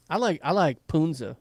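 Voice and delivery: usual speaking voice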